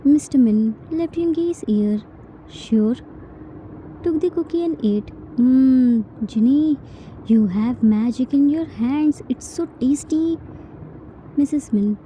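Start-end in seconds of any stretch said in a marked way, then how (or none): 5.4-6.0 mmm of delight. Acted. Father tastes cookie.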